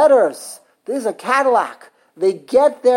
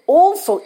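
Also, high and louder; stress on also; 9 semitones higher, 5 dB louder than usual. Shown as a demonstration. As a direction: punch Also. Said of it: "put emphasis also".

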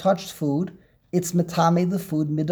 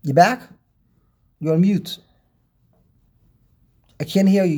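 raised voice over the line; calling out; 2 semitones higher, 5 dB louder than usual. Real, not acted. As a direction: call out loudly to be heard. Caller can't hear partner, on mute.

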